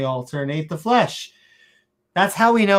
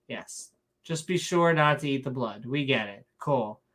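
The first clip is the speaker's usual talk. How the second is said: ordinary delivery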